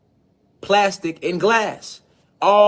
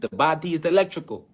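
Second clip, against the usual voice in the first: a light, understated hint of Sebi's Honduran accent, quieter; Doctor Sebi's accent; 4 dB quieter than usual. [name] quoting Sebi to viewers in a mild imitation of his Honduran accent. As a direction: mildly mimic Sebi's Honduran accent on "The body is electrical". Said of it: "You know, in his accent".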